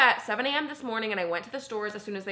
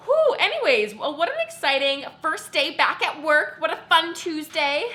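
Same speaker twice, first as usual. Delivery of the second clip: hyped sarcastic wrap-up; 7 semitones higher, 5 dB louder than usual. Real, not acted. Wraps up the chaos excitedly.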